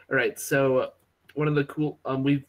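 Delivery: ordinary delivery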